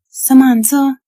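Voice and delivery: loud, high morning call; waking call. A narrator's reading. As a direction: call a child to get up for school. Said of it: "she called like when she woke me".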